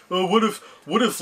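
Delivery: put-on dopey idea pitch; mocking pitch imitation. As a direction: mimic an unoriginal writer pitching ideas. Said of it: "how predictable that stupid channel is".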